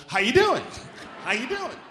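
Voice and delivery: high, loud, over-eager "How you doing?"; loud cheery Midwesterner. Retold or imitated. Mock Midwestern locals greeting him loudly. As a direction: pop up grinning and greet everyone. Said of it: "Holding tuna noodle casserole".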